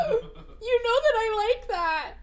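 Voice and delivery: high, slow, giggly gush; acted-out giddy delight. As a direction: squeal it out, thrilled by a small gift. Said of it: "I'm like".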